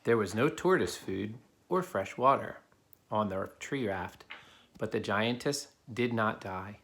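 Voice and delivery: put-on steady storybook narration for kids; reading a story aloud. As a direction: read it to the class like a picture book. Reads a picture book to children.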